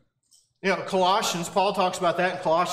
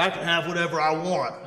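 lightly voiced macho man, a little lower; 3 semitones lower than usual. Mock macho men out there claiming entitlement, lightly voiced macho man. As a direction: give a slight macho swagger. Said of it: "no macho men out there".

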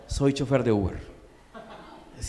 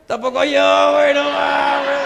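slow, loud, high stoner drawl; 5 semitones higher, 5 dB louder, slower than usual. Retold or imitated. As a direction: stretch the punchline like a stoned buddy. Stoner punchline, drawn out.

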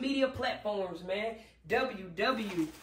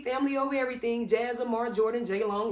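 usual speaking voice

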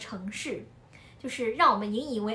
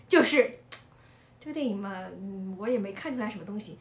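normal talking voice

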